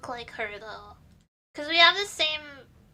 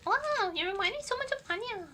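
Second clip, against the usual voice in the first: higher-pitched mock-amazed voice; imitated gushing commenter; 6 semitones higher than usual. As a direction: lightly imitate someone marvelling at a resemblance. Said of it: "Every day someone says".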